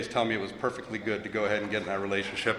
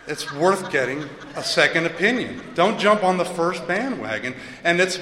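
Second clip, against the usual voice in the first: laughing after punchline; 6 semitones higher, 5 dB louder than usual. Real, not acted. Punchline, laughing at own joke.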